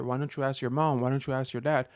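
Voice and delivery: ordinary talk, nothing marked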